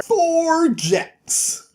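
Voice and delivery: high, proud card slap; spring the winning hand with glee; triumphant reveal. Retold or imitated. Jack shows the devil his winning hand, triumphant.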